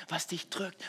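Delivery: usual speaking voice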